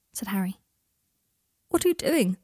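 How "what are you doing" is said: high, louder startled question. A narrator's reading. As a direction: pitch it up in alarm. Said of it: "asked Draco in a high voice".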